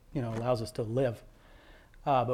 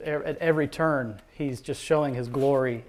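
normal talking voice

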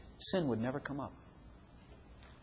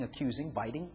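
usual speaking voice

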